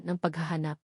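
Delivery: ordinary delivery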